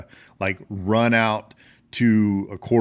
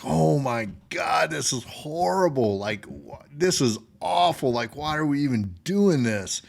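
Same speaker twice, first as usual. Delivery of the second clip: retold disgusted soldiers; 6 semitones higher than usual. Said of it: "they were like".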